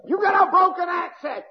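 shouted high, hammering the refrain; preaching shout. Real, not acted. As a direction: hurl the line at the congregation. Preacher repeats refrain, now shouted.

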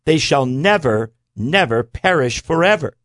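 "never, never" slowed and "forever" lifted high; stress on never and forever. Shown as a demonstration. Preacher driving home the promise, stressing forever. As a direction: draw out "never, never" and lift "forever". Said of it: "adds to this phrase the words".

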